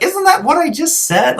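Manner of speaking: very high, incredulous retort; retold exasperation, pitch way up; exasperated protest. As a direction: squeak it out in mock disbelief. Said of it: "and I'd be like".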